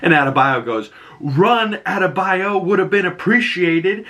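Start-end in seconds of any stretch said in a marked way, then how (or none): 1.2-4.0 high-pitched reproachful imitation; mock indignant complaint. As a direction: complain in a raised, put-on voice. Acting complaint after fleeing explosion.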